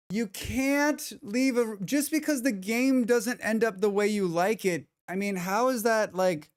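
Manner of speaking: high-pitched indignant rant; incredulous rant. Real, not acted. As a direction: rise up in disbelief. Ranting about reviewer.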